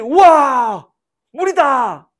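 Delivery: 높고 큰 감탄의 외침; 목마른 사람들이 물을 발견한 듯 환호하기; 군중의 환호. Retold or imitated. (물을 보고 신나서 외치는 소리)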